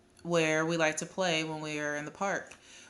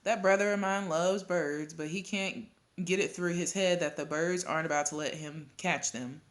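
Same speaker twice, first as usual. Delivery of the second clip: ordinary delivery, nothing marked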